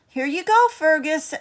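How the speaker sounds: put-on cheerful girl Emma. A narrator's reading. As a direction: hand the seeds over brightly, like a child. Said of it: "Emma said".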